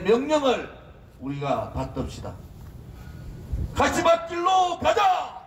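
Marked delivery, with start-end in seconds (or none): 3.7-5.4 rally cry, shouted slow and high; roaring rallying speech. Real, not acted. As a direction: roar it to the crowd. Leader chants, crowd echoes.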